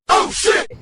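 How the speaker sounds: sudden loud curse, caught off guard; retold startled yell. Retelling waking up on gravel, startled shout. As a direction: yell it like you just woke up swerving.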